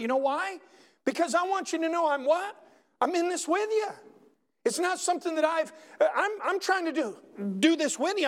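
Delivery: high, urgent preacher's appeal; heartfelt testimony rising over the congregation; impassioned preaching. Real, not acted.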